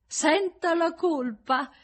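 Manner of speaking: high, loud, drawn-out mocking echo; nasty mimicry. A narrator's reading. Woman throwing his confession of guilt back, mocking. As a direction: parrot him slowly in a high, spiteful voice. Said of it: "gli rifece il verso lei, cattiva".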